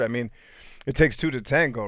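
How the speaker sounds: normal talking voice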